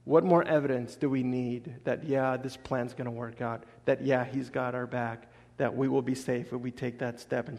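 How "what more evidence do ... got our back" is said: ordinary talk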